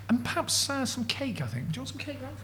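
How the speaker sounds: lightly posh-voiced host offering cake; genteel host. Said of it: "Jimmy goes".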